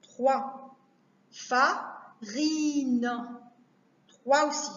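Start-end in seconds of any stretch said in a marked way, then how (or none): 1.3-3.1 "Farine" slowly as Fa... ri-ne; "Farine" in spaced beats; split into syllables. Shown as a demonstration. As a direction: stretch and separate the syllables of "Farine". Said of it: "couper les mots en syllabes".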